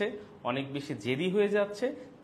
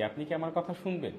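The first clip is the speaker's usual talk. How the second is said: ordinary talk, nothing marked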